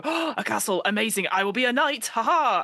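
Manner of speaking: eager improviser. Retold or imitated. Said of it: "People go".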